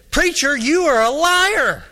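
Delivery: high, slow mock outrage; retold outraged accusation. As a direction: draw out each word as an acted accusation.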